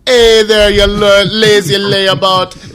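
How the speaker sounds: high, loud sneering call; loud taunting shout. Pretend. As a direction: bellow it like a mocking giant. The darkness speaking in dream.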